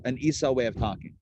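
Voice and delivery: normal talking voice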